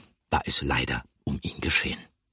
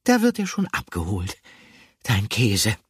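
sleepy call; 4 semitones higher, 3 dB louder than usual. A narrator's reading. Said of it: "rief Gruyère schläfrig".

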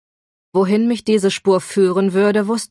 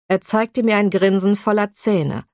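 ordinary delivery, nothing marked

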